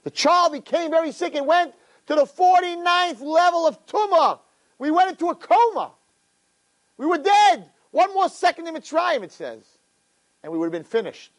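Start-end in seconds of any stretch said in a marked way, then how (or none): 0.0-9.2 high-pitched, ringing sermon delivery; impassioned preaching. Real, not acted. Preacher tells of the awaited nation's birth, high fervent cry.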